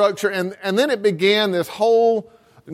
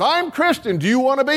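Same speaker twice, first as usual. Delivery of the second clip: a hammed-up king, pitched higher; mock king; 7 semitones higher than usual. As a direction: toss off the king's question as a joke. King's mock offer of faith.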